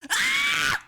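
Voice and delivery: very high, loud shriek dissolving into laughter; delighted scream. Real, not acted. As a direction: shriek with joy then laugh it out. Screaming at model.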